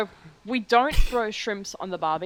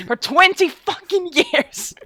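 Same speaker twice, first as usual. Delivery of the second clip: high, drawn-out playful yell; mock exasperated shout; 10 semitones higher, 9 dB louder, slower than usual. Friend exaggerating a wait for one shrimp, mock exasperated shout. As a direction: shout it slowly, in mock outrage.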